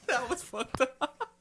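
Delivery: high, slow words through laughter; laughing. Real, not acted. Laughing at friends' instigating.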